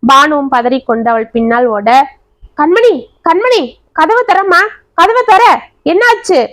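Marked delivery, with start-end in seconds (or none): 2.6-6.4 panicky, much higher and rising; frantic calling. Acted. Grandmother frantically calling Kanmani through the door, panicky and high. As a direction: pound on the door calling for the girl in alarm. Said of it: "படபடவென கதவை தட்டினாள்".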